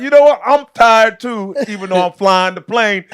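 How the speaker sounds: hammed-up pilot quote; mock pilot. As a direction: imitate a pilot casually giving up, loudly. Mimics a weary pilot, amused.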